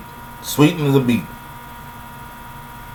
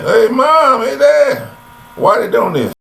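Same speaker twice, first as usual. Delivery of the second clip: put-on whining child; 10 semitones higher, slower than usual.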